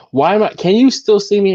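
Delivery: ordinary talk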